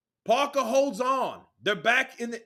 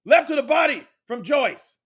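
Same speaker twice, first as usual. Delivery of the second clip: ordinary talk, nothing marked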